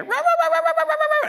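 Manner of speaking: high loud yappy barks; dog barking. Retold or imitated. Preacher imitating neighbour dogs barking at walkers, loud high barks. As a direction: yap furiously like a guard dog. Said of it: "I'll hear".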